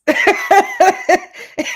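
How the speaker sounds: high, loud burst of laughter; real delighted laughter. Real, not acted. Host laughs after poem.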